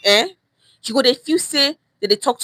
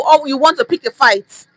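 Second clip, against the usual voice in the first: usual speaking voice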